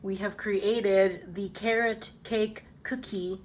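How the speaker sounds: slow, measured reveal of the finished bake; slow announcement. Real, not acted. Baker presenting her finished cookie to viewers, slow announcement. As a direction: announce it word by word, drawn out.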